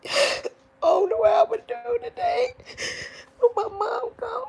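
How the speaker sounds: anguished wailing sob. Real, not acted. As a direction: cry it out in full grief. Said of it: "when you're crying and stuff".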